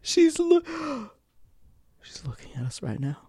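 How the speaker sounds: high gasp, dropping to a hushed voice; scared child impression. Cohost imitating actor peering over a shoulder, frightened hushed child. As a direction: start squeaky, gasp, then go very quiet. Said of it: "and is like".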